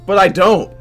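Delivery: loud, high outburst of denial; emphatic protest. Acted. Narrator arguing with own thoughts about becoming CEO, emphatic protest. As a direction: blurt it out in protest.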